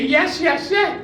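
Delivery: high, rising, giddy acceptance; excited yes. Acted. Old woman accepting the marriage proposal, giddy and high.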